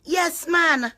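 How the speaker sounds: high, gleeful affirmation; excited yes. Real, not acted. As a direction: fling it up in triumph. Repeated affirmation.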